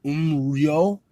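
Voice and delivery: Umryo as "um", R, then "yo"; blended syllables. Shown as a demonstration. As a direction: glide Umryo from um through R into yo. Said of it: "say um and then put the R".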